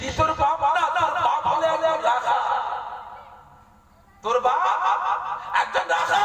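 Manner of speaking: high, laughing mother voice; mock confession. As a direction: confess it with mocking glee. (হাসতে হাসতে স্বীকারোক্তি)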